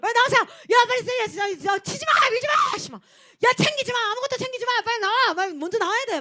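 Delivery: high, loud chaotic shouting; frantic yelling. Retold or imitated. Staff screaming at pushing campers to leave everything, yelling. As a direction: bark orders over the crowd in panic.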